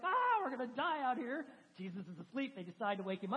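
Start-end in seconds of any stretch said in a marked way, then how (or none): 0.0-1.5 higher, louder cry of alarm; imitating panicking sailors in a storm; mock panic. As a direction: play the scared disciples half in jest. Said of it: "they were panicking".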